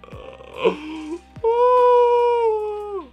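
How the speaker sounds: long high wail of frustration; frustrated wail. Real, not acted. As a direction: gasp into a high, loud wail. Frustrated by lagging video chat.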